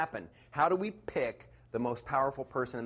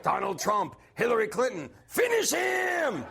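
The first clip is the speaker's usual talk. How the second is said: Mortal Kombat announcer; 7 semitones higher than usual. Retold or imitated. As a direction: boom like a fighting game announcer. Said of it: "You know, like".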